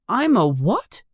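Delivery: put-on high, astonished child voice; high-pitched startled question; gasped surprise. A narrator's reading. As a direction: ask in stunned disbelief as a boy. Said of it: "gasps Harry".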